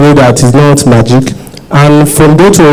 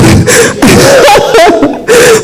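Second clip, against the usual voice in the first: high, nervous laugh close to tears; emotional laugh. Real, not acted. Photographer asking a question, a high nervous laugh. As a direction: laugh it off while choking up. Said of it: "I'm just being emotional".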